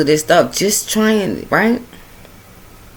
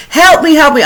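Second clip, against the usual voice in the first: high, louder pleading cry; retold scream for help; 10 semitones higher, 4 dB louder than usual. Friend screaming at passers-by for help. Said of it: "and she's screaming".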